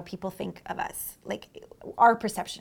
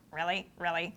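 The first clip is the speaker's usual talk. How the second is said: quiet skeptical; 5 semitones lower, 9 dB quieter than usual. Retold or imitated. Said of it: "I'm just like".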